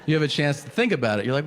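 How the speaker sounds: ordinary talk, nothing marked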